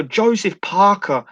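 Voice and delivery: slow, drawn-out Boston voice; slow impression. Imitating a slow-talking podcast guest. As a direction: stretch every word, imitating. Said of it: "He talks, like, very slow".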